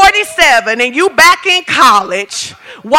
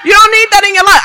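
louder, higher, laughing through it; laughing shout; 8 semitones higher, 7 dB louder, faster than usual. Real, not acted. Preacher laughing and shouting the advice to the woman. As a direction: shout it while cracking up. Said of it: "repeated, louder with laughter".

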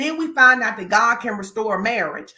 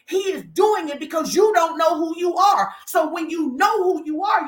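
normal talking voice

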